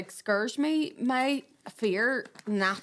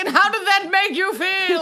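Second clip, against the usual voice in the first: mock therapist; 8 semitones higher, 7 dB louder than usual. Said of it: "You're sitting there".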